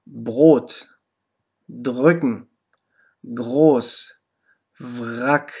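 „Brot“ mit stimmhaftem R nach dem B, sorgfältig vorgesprochen; deutliche Aussprache. Shown as a demonstration. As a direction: jedes Wort einzeln und klar zum Nachsprechen. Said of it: "Zum Beispiel". (Lehrerin spricht Beispielwörter deutlich vor)

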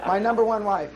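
laughing through the words. Real, not acted. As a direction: chuckle as you repeat it. Repeats it laughing.

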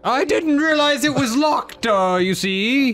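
put-on English accent. Voicing the spy character.

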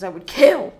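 loud, over-the-top villain vow; hammed-up loud threat; dramatic declaration. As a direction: belt it out like a melodramatic monologue. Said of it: "Okay, that's a little much".

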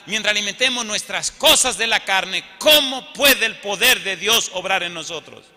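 loud, high, impassioned sermon delivery; forceful pulpit proclamation, loud and high; full-force preaching. Real, not acted. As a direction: preach it out with hammered key words.

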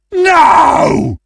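high, loud cry of defeat; hammy howl of protest; anguished shout. Acted. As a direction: wail it as the game is lost. Reacts to losing the game.

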